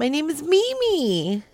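high, playful mock introduction; put-on Mimi voice. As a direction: sing out the name in a high, silly voice. Playful put-on voicemail greeting bit.

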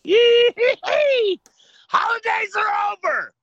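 high gleeful shrieking cheer; excited shout. Real, not acted. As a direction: whoop it out, delighted. Celebrating end of holidays.

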